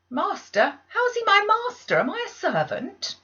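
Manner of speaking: high, loud defiant outburst; defiant girl protesting to the maids; indignant protest. Acted. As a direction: throw the question back at her.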